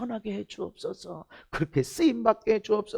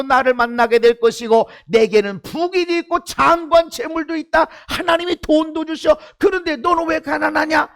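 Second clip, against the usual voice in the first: scornful taunt; 6 semitones higher, 6 dB louder than usual. Retold or imitated. Preacher voicing a scornful challenger.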